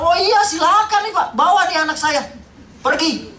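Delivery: very high, loud shouting; retold outburst. Father sending doctors off to recheck, loud high shout. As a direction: shout it out at full pitch.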